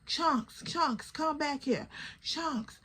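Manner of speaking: high, soft mock call; calling a friend back. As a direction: half-heartedly call after a wandering friend. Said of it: "telling Chunks to come back".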